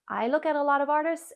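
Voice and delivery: ordinary talk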